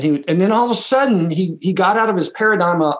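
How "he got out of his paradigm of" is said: ordinary talk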